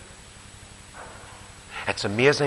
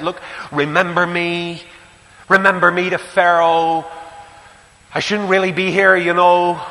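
high, louder urgent plea; pleading prisoner; 5 semitones higher, 5 dB louder than usual. Acted. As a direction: beg him not to forget you. Joseph pleads with the butler.

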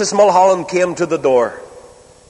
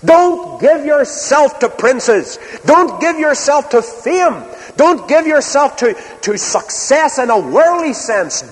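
slow, higher, urgent sermon delivery; impassioned preaching; 7 semitones higher, slower than usual. Acted. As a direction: plead with the flock to hold on to what lasts. Preaching, urging the congregation.

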